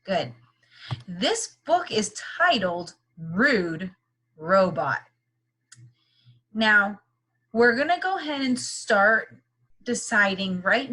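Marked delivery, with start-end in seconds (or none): 1.1-5.0 slow title announcement. Shown as a demonstration. Teacher announces book title.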